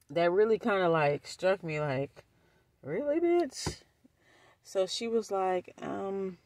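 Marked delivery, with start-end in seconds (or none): 2.8-3.8 high, softer incredulous question; mock disbelief. Speaker reacting to cousin's comment, high quiet disbelief. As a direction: let it climb in pointed disbelief.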